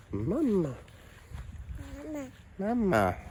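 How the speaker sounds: coaxing the little one sweetly. Real, not acted. Coaxing toddler to say mama.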